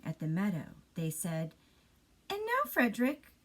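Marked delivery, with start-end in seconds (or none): 2.3-3.3 much higher, curious little mouse. Acted. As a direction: ask it like a tiny eager mouse. Mice asking again.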